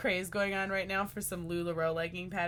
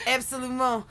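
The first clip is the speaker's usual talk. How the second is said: put-on louder, higher French accent; mock French accent; 4 semitones higher, 6 dB louder than usual. Showing off French to the co-host, mock French accent.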